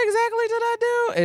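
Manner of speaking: squeaky bewildered question; very high, puzzled voice; high confused voice. Retold or imitated. Retold drunk confusion.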